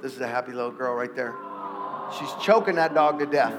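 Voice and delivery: soft, low, hushed preaching; tender sermon. Acted. Pastor telling the congregation softly about God's timing, hushed. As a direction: draw the church in gently.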